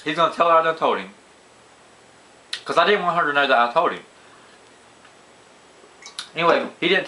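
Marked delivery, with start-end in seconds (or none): 0.0-1.1 retold panic. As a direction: blurt it out like you just got caught. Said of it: "I was like".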